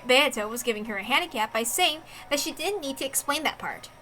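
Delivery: normal talking voice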